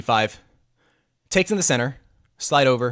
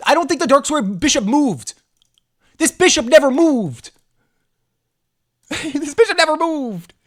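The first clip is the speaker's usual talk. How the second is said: mocking high voice; 12 semitones higher, 6 dB louder than usual. Mocking opponent's undeveloped bishop.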